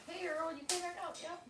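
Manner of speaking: high soft pet talk; high pet greeting. Real, not acted. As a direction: welcome the bird back softly. Greeting the returning bird.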